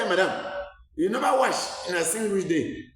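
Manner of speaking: high, scornful Pidgin taunt at the patient; mocking pidgin jibe. Senior midwife mocking a smelly patient in pidgin, scornful and high. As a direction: sneer it like a rude senior midwife. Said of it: "That is pidgin in my country here".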